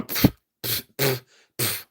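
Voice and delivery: puffing out troll hair; short voiceless "pff" puffs; imitated spitting. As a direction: sputter as if clearing hair from the mouth. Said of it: "and I kept going".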